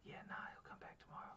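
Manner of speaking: retold quick, very soft whisper. Man hiding from his P O brushes it off, whispering. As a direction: whisper it fast, barely voiced. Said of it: "Why are you whispering".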